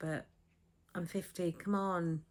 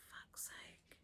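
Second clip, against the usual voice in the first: whispered curse; 23 dB quieter than usual. Real, not acted. Exasperated thought voiced.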